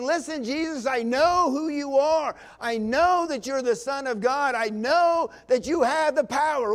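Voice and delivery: loud, high, certain declaration; bold confidence. Retold or imitated. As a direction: proclaim it with total faith. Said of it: "Can you hear the confidence?".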